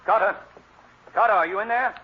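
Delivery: high, loud call into the next room. Acted. Cranston calling into the bedroom for Carter, loud. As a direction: shout to find him.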